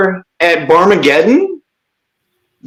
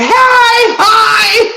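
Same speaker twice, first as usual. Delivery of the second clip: squeaky fan greeting; 13 semitones higher than usual. Retold or imitated. Mimicking fans greeting at shows.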